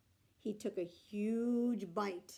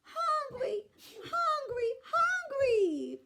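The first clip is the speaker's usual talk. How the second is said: put-on very high loud baby wail; high loud baby holler; 7 dB louder, much slower than usual. A narrator's reading. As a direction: wail like a huge hungry baby. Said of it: "The baby began to holler too".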